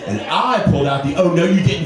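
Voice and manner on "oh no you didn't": sassy. Retold or imitated. Mimics sassy talk-show finger move.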